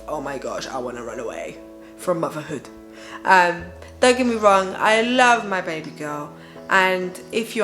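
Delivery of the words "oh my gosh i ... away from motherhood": low, quiet, drained inner thought; despairing inner voice. Retold or imitated. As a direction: mutter it like you're worn out. Inner thought of weary mum.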